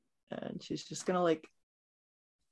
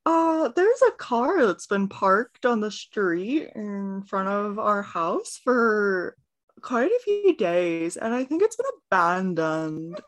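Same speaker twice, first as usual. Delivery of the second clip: Cheryl; 11 dB louder than usual. Acted. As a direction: mimic someone badly, stretching the words out loudly. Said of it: "mimicking Cheryl's voice and not sounding like herself".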